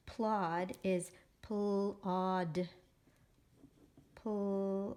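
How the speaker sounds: "plod" pulled apart as pl and od; slow phonics segmenting; sounding out. Shown as a demonstration. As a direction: stretch "plod" slowly, pausing inside it. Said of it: "write it by the syllable".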